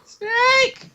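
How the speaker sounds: high, squealed name; excited. Real, not acted. Naming Snake gleefully.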